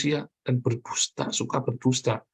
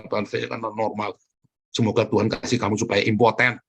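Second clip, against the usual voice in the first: ordinary delivery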